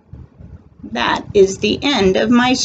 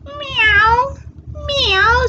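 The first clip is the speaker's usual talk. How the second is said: very high mewing; cat meow. Acted. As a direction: mew like a little kitten. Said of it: "says the cat".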